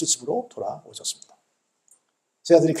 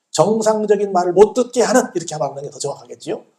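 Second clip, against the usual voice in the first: ordinary talk, nothing marked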